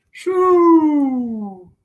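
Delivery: high, loud whistling snore. Acted. Snore sound effect.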